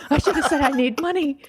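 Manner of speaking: high, half-laughing self-scolding; mock regret. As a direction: kick yourself for a missed chance, laughing. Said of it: "And I'm immediately like".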